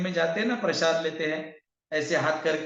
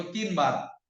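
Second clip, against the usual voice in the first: ordinary delivery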